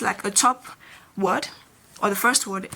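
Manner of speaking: ordinary talk, nothing marked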